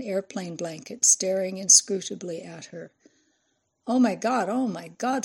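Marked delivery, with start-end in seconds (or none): 3.9-5.2 higher-pitched, stunned exclamation; gasped shock. A narrator's reading. Reporter finding a child alive in the brush, gasped shock. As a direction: gasp it out in astonishment. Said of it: "Fran gasped".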